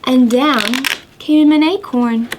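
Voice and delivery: sing-song rhyme with gasping wind effects; counting rhyme for kids. Acted. Teacher reading a counting rhyme to children, sing-song. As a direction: read it like a children's counting game.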